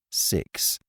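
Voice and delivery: breathy whispered answer; barely voiced, smug.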